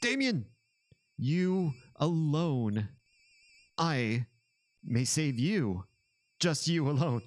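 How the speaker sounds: slow, high-pitched villain bargaining; booming echoing villain monologue. Acted. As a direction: stretch out the villain's offer dramatically. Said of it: "Evil monologue".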